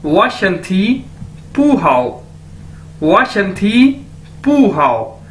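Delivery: वाशंथी पू हाओ said slowly, syllable by syllable; slow model sentence. Shown as a demonstration. Teacher slowly models the Chinese sentence for students. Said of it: "तो कैसे कहूंगा?".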